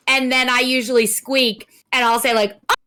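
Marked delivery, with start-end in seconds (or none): none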